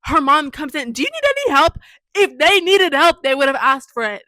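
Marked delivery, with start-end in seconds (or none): 0.9-1.8 very high, loud imitation of a mom; mocking high-pitched offer; mock intrusive mom. 2.1-4.2 loud exasperated comeback; indignant. Real, not acted. Indignant reply to the mom's offer.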